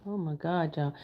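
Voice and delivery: normal talking voice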